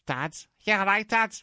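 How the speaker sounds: loud, high Scouse daughter; hammed-up Liverpool accent. Parodying the undubbed daughter in a broad Liverpool accent. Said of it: "her accent wasn't even that strong".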